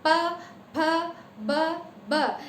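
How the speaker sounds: प, फ, ब sounded with both lips; sound demo. Shown as a demonstration. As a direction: pop प फ ब off the lips. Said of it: "ये होठों से बोले जा रहे हैं".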